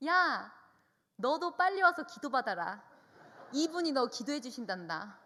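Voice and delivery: loud call across the bathhouse; calling loudly. Retold or imitated. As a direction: call her daughter from the showers. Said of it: "큰 소리로 불러요". (샤워하는 딸을 큰 소리로 부르듯)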